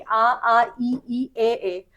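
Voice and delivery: unrounded vowels आ, ई, ए said slowly and clearly, lips spread; vowel demo. Shown as a demonstration. Teacher showing students the unrounded vowels, each one carefully articulated. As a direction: sound out आ, ई and ए as a model for the class. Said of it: "आप इनका उच्चारण करिए".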